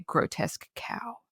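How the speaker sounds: hushed, breathy mock mutter; half-whispered imitation of a husband's aside; retold muttered insult. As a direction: say it under the breath, as if overheard.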